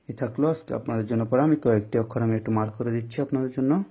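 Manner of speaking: ordinary delivery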